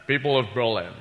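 put-on slurred Scottish accent doing Kennedy's speech; Sean Connery as Kennedy. Comic doing Sean Connery doing Kennedy, Scottish accent. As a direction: lean into a Scottish Connery lisp on citizen. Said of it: "Sean Connery doing, uh, President Kennedy".

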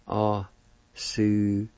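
"Osuyesti" very slow, broken into drawn syllables. Shown as a demonstration. Teacher repeats word for pick to learners, syllable by syllable. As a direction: stretch out "Osuyesti" part by part. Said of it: "it's pronounced like this".

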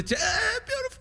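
high-pitched, choked-up blubbering; overcome, squeal through hammed-up tears; mock crying. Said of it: "everything made him cry".

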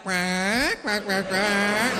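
slow, drawn-out rising quacks; long, whiny duck call; female duck quacking. Retold or imitated. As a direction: quack like a scolding female duck, stretching the notes. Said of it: "right away the female in the".

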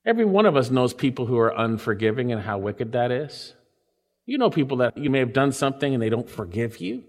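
ordinary talk, nothing marked

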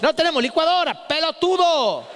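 high-pitched mock scolding; irate mother yelling back. Mother answers son from the laundry room, high irate yell. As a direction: play a mother hollering an insult back.

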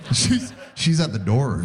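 ordinary delivery

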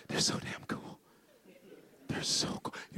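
hushed whisper of admiration; awed whisper. Real, not acted. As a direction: whisper it like a starstruck fan. Admiring the pilots.

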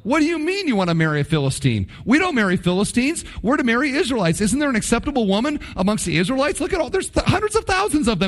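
rising, high-pitched, hurried protest; devastated parents. Acted. Parents reacting to son wanting a Philistine wife, distraught. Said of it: "They are devastated by this".

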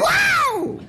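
a leaping high wow; answering the singer's call with a high wow; excited wow. Retold or imitated.

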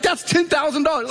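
high-pitched imitation of a stunned wife; astonished wife. Wife seeing the big check, high astonished voice. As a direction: jump the voice up in wifely amazement.

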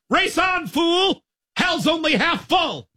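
high shouted taunt, slowing on the last line; old-timey yelled insult; mock road-rage yell. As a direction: yell it out the car window. Dad yelling at fast driver.

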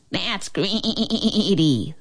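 slow drawn-out bleat; bleating goat. A narrator's reading. Goat scolding the thief, slow bleat. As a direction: stretch it like a goat. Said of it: "the goat bleated".

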